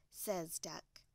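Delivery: ordinary delivery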